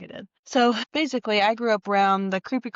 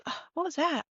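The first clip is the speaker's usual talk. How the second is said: pitched up, softer, like a spooked coworker; startled question; 9 semitones higher, 4 dB quieter than usual. Retold or imitated. New girl at the fence, startled by the whistle, asks nervously. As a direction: sound suddenly unnerved by the noise.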